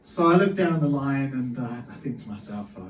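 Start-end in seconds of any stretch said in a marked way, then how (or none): none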